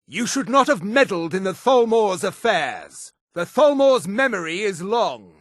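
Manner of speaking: high, indignant Thalmor warning; haughty, high-pitched menace; menacing threat. Acted. Thalmor enemy threatens the player.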